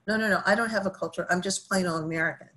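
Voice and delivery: rapid, insistent no no no; fast insistent denial. Retold or imitated. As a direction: brush it off quickly. Participant's emphatic denial.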